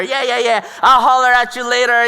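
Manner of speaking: put-on cool brush-off for an audience; playing it off. Guy showing off before his friends, mock playing it off. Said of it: "trying to play it off in front".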